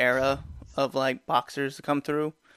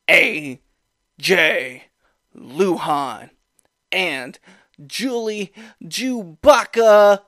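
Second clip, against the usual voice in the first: announcer; 4 semitones higher, 6 dB louder, much slower than usual. Acted. Drawn-out name introduction.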